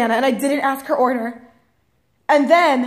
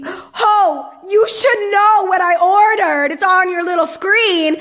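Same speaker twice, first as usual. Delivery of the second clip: high, slow exasperated scolding; hammed-up outraged customer; indignant rant; 6 semitones higher, 3 dB louder, slower than usual. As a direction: stretch out each word in raised indignation. Mock rant at worker wanting receipt.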